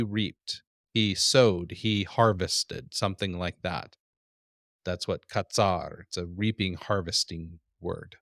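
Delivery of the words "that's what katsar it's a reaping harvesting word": normal talking voice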